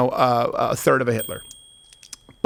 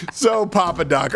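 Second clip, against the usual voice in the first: ordinary talk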